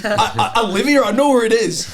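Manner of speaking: high, loud, incredulous outburst; fed up, almost laughing; exasperated retort. Retold or imitated. Lad snapping back at the director, loud and exasperated.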